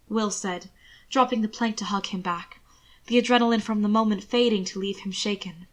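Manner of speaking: ordinary delivery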